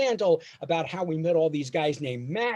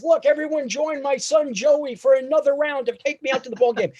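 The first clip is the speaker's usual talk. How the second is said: announcing to the whole stadium, higher and louder; proud dad rallying the stands, pitched up; rallying the crowd, higher; 9 semitones higher, 4 dB louder than usual. Retold or imitated. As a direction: call everyone to sing along, high.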